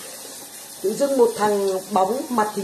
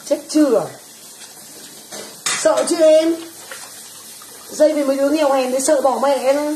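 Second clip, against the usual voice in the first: hammed-up fright; 4 semitones higher than usual. Mock fear of poor people.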